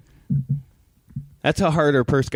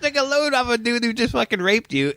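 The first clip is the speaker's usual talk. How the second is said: mock appalled; 13 semitones higher than usual. Viewer to movie woman, high appalled disbelief.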